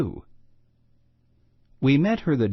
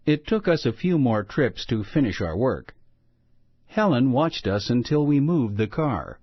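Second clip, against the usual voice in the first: ordinary delivery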